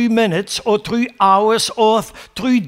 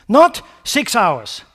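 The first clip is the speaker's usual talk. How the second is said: ordinary talk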